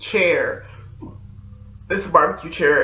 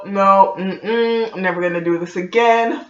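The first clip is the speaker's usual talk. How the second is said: ordinary delivery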